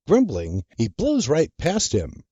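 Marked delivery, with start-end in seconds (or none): none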